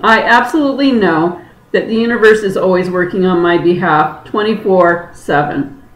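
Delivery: ordinary talk, nothing marked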